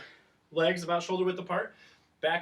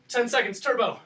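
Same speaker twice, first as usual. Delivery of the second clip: higher and louder, driving the class; pumped-up push; 7 semitones higher, 5 dB louder than usual. Real, not acted. Coach urging the class into turbo, excited and loud. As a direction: fire the class up for the finish. Coach pushing final ten seconds.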